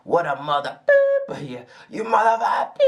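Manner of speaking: acted-out fury climbing up to a squeaky top; mock rising rage. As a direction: play the inner rant until it boils over in a mock shriek. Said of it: "You start playing".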